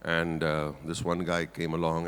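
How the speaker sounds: ordinary talk, nothing marked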